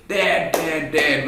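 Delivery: put-on high eager calls, a child tugging at dad; excited kid. Child begging his busy dad to play, excited and high. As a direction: call out to dad like a thrilled child. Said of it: "very excited".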